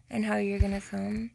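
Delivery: normal talking voice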